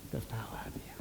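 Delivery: whispered aside. Real, not acted. Preacher whispers aside in story.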